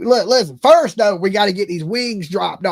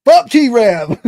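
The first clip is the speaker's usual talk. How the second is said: hammed-up fed-up curse; imagined dasher snapping at the host; mock angry outburst; 2 semitones higher, 8 dB louder than usual. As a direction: burst out louder in mock anger. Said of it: "I'd be like".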